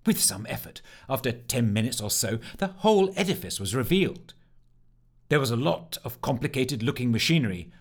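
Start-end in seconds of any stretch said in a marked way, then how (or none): none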